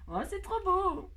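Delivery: a high, slow oh of delight; delighted gush. Real, not acted. Crafter admiring the dove she just placed, high delighted gush. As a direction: gush over how pretty it is.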